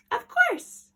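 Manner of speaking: put-on high, bright girlish cry of yes; thrilled little girl agreeing; eager cry. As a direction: leap at the errand with delight. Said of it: "cried Little Red".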